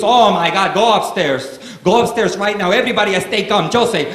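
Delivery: high-pitched Cuban dad working himself up; hammed-up novella-style fluster, Cuban accent; mock hissy fit. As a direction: imitate a father panicking while ordering calm. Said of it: "go into these hissy fits about everything".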